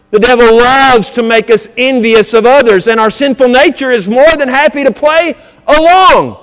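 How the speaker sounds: loud, high, ringing preacher's voice; fired-up Sunday sermon cadence; preaching at full force. Acted. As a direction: preach it out to the whole congregation.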